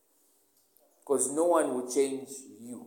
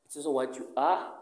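normal talking voice

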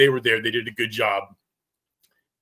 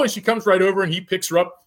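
usual speaking voice

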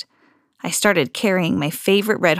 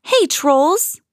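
raised high call; 7 semitones higher than usual. A narrator's reading. Girl calling to squabbling trolls, raised voice. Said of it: "I called".

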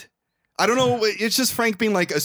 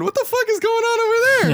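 high-pitched, incredulous outburst; baffled passerby; 5 dB louder than usual. Acted. Passerby stumbling on the odd scene, high incredulous outburst. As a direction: blurt it out like a stunned bystander.

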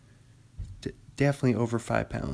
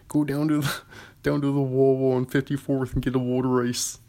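put-on thick Philadelphia accent, L's swallowed. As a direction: imitate the Sklar brothers' Philly local, Philadelphia accent. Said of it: "They're like".

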